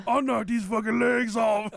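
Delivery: loud, high panic, hammed up; imitated terrified screaming. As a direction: mimic a driver screaming in horror. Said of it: "He just starts screaming".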